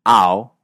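loud open ow as in US English. Shown as a demonstration. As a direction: stretch "ow" with a wide open A. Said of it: "en lugar de oírse una A". ("ow" con A abierta)

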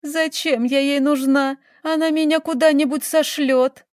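high, plaintive tearful wail; sobbing. A narrator's reading. Girl fears aunt will send her away, wailing tearfully. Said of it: "продолжала рыдать девушка".

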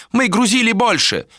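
high, insistent objection; heated protest. A narrator's reading. Elder argues over loading, heated high protest.

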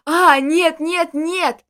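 loud screaming; anguished scream. A narrator's reading. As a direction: scream it, tearing the voice. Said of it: "надрывая связки, заорал".

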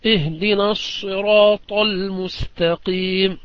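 الصراط with a pure, heavy emphatic sad, slow. Shown as a demonstration. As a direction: hold a clean sad in الصراط, no z. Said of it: "بالصاد الخالصة".

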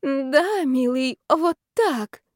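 high, drawn-out pretend pleasure; faked passionate moan. A narrator's reading. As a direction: moan for the eavesdroppers' benefit. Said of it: "похожее на стон страсти".

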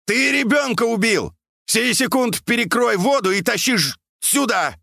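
high, accusing yell; urgent high-pitched bawling; furious shouting. A narrator's reading. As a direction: shout accusations and orders across water. Furious shouting across the water.

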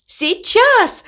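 high-pitched, ringing exclamation; excited cry. A narrator's reading. Cow announcing her big moment, excited cry. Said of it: "закричала мама Му".

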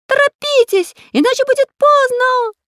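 high, loud, squeaky urging; shrieking sly bird. A narrator's reading. Parrot hurrying rescuers into trap, shrieking. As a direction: yell it shrilly like a cartoon bird. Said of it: "вопила подлая птица".

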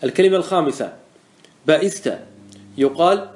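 ordinary talk

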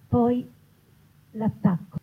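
usual speaking voice

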